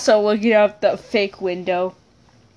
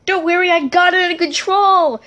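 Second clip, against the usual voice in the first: lightly voiced hero, higher; a hint of a brave pilot; heroic; 7 semitones higher, faster than usual. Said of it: "[name] said in a heroic voice".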